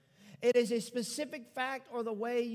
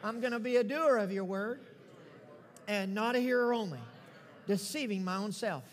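bold declaration. Acted. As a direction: proclaim each line for the church to repeat. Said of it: "let's boldly declare".

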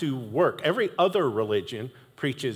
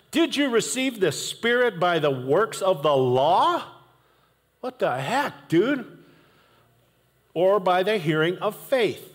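higher, pointed rhetorical questions; scolding; 5 semitones higher than usual. Retold or imitated. Paul grilling the Galatians, annoyed. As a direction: press them hard, annoyed. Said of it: "he kinda lets them have it".

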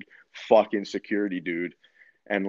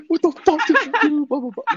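much higher, louder mock outburst; dad blowing up; 6 dB louder than usual. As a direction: burst out like a furious father on the phone. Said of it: "He started to go off".

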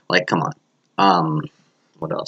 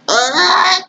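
sudden very high yelp; startled yelp; 4 dB louder than usual. Real, not acted. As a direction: yelp at a slip-up. Startled at own mistake.

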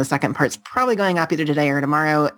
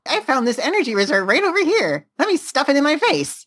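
much higher, bouncy excitement; hyper caffeinated; 11 semitones higher than usual. Retold or imitated. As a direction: gush like the caffeine just hit. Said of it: "it was like".

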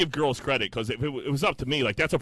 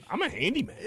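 puffed-up high bragging voice, a touch hammed; mock proud handyman; 10 semitones higher, 3 dB quieter than usual. Bragging to friends about the new toilet, mock proud.

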